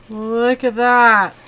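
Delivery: slow, loud, marveling; drawn-out amazement. Real, not acted. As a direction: stretch it out in awe. Amazed at the extra pink.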